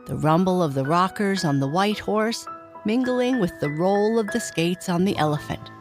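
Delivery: plain reading voice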